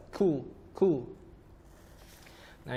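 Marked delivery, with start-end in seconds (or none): 0.1-1.1 light "ku" with audible breath; breathy kaf. Shown as a demonstration. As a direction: let air escape on each "ku". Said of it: "ada bunyi hamas".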